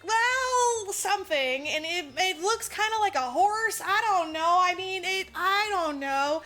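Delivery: high, loud, waffling excuse; acted-out squirming explanation; flustered mock evasion. Character evasively explains what he hit.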